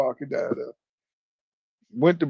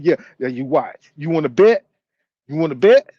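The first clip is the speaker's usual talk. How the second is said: louder taunting challenge, bet me; mock taunt; 2 semitones lower, 4 dB louder than usual. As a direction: dare him, swaggering. Cousin mimicked, taunting challenge.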